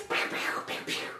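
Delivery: unvoiced playful pew-pew shots; toy gun noises. Child playing cowboys with playmates, imitated gunfire. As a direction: mouth little pistol shots.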